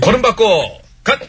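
drill command. Acted. Drill command while walking.

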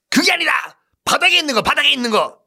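high, loud snapping explanation; impatient high shout. Acted. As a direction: snap at the dim soldier.

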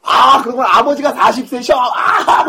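high, slow mock-amazed holler into a guffaw; overdone fake laughing. Schoolboy fawning on cool friends, overdone fake laughing. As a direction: crack up loudly over nothing, put on. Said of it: "별거 아닌 거 가지고 웃고".

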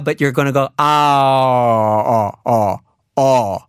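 "ah" with rounded O-shaped lips; saying ahh. Shown as a demonstration. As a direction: say "ah" with an O face. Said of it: "an ah sound with an O face".

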